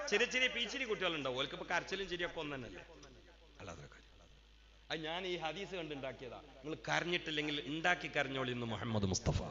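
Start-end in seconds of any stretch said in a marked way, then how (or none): none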